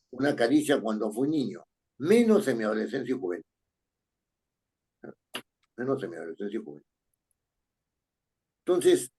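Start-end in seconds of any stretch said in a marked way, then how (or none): 2.0-3.4 quick read-aloud of the sentence; reading the line. Shown as a demonstration. Teacher reading the sentence to the class, quickly. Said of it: "repeats it quieter". 5.8-6.8 softer, very fast repeat of the line; quiet repeat. Shown as a demonstration. Teacher rechecking the sentence for students, softly and fast. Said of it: "repeats it quieter".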